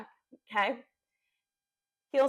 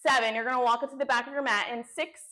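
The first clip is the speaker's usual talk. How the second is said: ordinary talk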